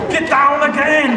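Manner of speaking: high, hammed-up yelled warning; accented mock movie shout; action-hero shout. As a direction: yell it like an action star. Action hero shouts in parody trailer.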